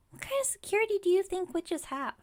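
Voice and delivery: high-pitched, incredulous challenge; exasperated indignation. Acted. Witch defends her lock wards to customer, exasperated. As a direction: scold them in exasperated disbelief.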